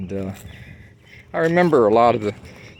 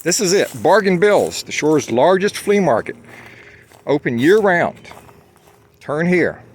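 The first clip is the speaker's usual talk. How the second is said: high, loud small-town commercial read; proprietor's TV ad voice; 7 semitones higher, 4 dB louder than usual. Retold or imitated. Local flea market owner hawking his business on TV, loud and upbeat. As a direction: pitch it like a cheesy local TV ad. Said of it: "it went something like this".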